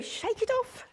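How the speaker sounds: sudden high, sing-song shake it off; playful shake it off. Acted. Instructor cheering her class to shake off their bad day, playful high lilt. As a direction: bounce it up like a dog shaking water off.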